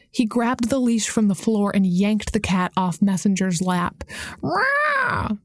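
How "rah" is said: high, sharp yowl of an angry cat; cat's cry. Acted. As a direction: let out a sudden shrill cat screech. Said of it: "the cat cried".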